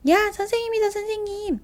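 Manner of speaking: put-on high, loud excited child's call. Little brother spotting his teacher, high loud call. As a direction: shout happily across the bath. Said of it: "큰 소리로 선생님을 부릅니다".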